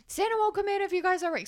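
put-on high, fast, insistent child voice; urgent little kid. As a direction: beg everyone like an anxious four-year-old. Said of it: "he was like".

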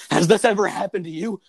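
hammed-up lawyer commercial question; lawyer ad pitchman. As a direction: sell it like a cheesy legal ad. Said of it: "those lawyer ads where it's like".

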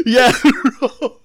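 high, loud laughing yeah. Real, not acted. Laughing along with the friend about his own blunder.

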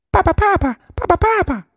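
very high chirpy duckling voice; a tiny duckling crying out for papa; 小鸭子尖声叫爸爸; duckling calling papa. A narrator's reading.

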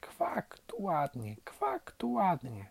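high, thin, excited duckling peeps; delighted squeaking ducklings. A narrator's reading. Newly hatched ducklings marvel at the world, delighted squeaking. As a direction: squeak it in wonder, drawing out the second quack. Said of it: "popiskiwały zachwycone".

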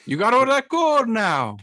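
high, loud Irish leprechaun voice; Irish accent. Pretend. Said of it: "Every time you do the, an Irish accent".

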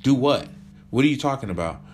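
high, loud angry confrontation; heated quarrel. Acted. Girlfriend confronting John about the arrangement, loud angry fight. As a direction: fire every line back at him.

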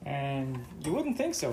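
ordinary delivery

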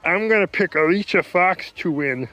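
high, dopey mock voice of a friend; imitating Brandon. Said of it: "That's basically what he sounds like".

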